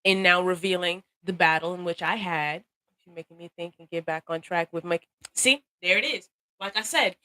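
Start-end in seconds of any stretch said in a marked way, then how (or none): none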